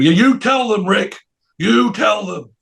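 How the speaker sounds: mock imperious insistence; acted insistent order. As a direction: press the order home. Acts Brian ordering Rick.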